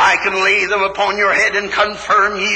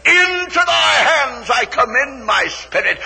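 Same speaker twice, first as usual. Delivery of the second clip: anguished cry; 5 semitones higher than usual. Acted. Jesus on the cross, commending his spirit with a sob. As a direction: cry it out, breaking.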